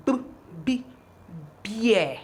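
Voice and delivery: "b, b" as separate sounds, then blended "beer"; sounding out. Shown as a demonstration. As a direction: build "beer" from its first sound. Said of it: "this B is sounding B".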